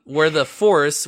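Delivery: ordinary delivery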